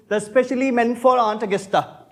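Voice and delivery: ordinary talk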